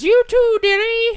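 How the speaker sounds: mimicked shrieking crone, very high and loud; loud mock old-lady screech; fortune teller. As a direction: imitate the crone's piercing falsetto call. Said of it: "And she says".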